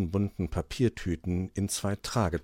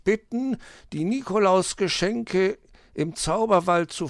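higher fox voice reading the letter out; reading aloud loudly; 12 semitones higher, 4 dB louder than usual. Acted. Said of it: "Sie haben es ja laut genug vorgelesen".

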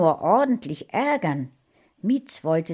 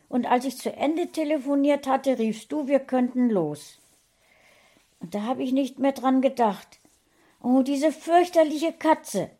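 hohe, vorwurfsvolle Frauenstimme; aufgebrachte Ehefrau; 7 semitones higher, 3 dB louder than usual. A narrator's reading. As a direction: die Frau wirft dem Mann was vor. Frauchen streitet mit Herrchen. (aufgebrachte Frau, hoch und vorwurfsvoll)

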